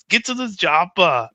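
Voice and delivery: half-hearted Austrian muscleman impression; Arnold impression. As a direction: toss off the movie line in a thin Austrian voice. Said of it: "It's the only one I can do".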